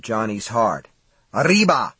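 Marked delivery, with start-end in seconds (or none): 1.3-1.9 loud, higher exclamation; grunted cry. A narrator's reading. Johnny to the coyote he is wrestling, grunted cry. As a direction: call it out with a burst of effort. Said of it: "he grunted".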